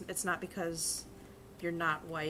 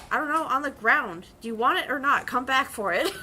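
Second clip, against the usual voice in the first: exasperated retort; 5 semitones higher, 9 dB louder than usual. Retold or imitated. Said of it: "And I'm like".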